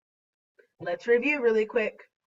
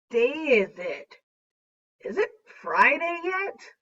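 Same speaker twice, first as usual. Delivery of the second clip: "yet" swoops up high to ask; questioning upward inflection; 3 semitones higher than usual. Acted. Teacher voices Question Mark's example, upward inflection on "yet". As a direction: lift the end of "yet" like a real question. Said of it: "a little bit of upward inflection".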